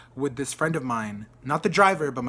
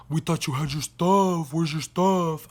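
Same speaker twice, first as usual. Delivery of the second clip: mocking the friends; slower than usual. Mocking her friends' retold questions.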